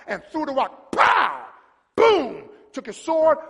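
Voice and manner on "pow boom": high, loud "pow" and "boom"; imitated impact noises. Preacher telling the rock hitting Goliath, explosive. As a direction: shout the sound effects.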